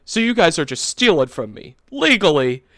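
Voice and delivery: retold loud accusation; indignant customer. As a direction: imitate an accusing customer, rising in disbelief. Retold angry customer confronts the clerk.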